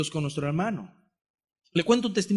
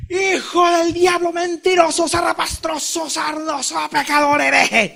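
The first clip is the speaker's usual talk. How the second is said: high, loud pretend rant of insults; mock tirade; 9 semitones higher, 4 dB louder, slower than usual. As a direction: hurl the names like a hammed-up tantrum. The insults he did not say.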